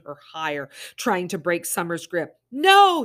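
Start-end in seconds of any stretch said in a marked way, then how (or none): 2.5-3.0 high, loud desperate scream. Acted. Summer screaming at the man holding Early. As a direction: shriek it out in terror. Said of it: "Summer screamed".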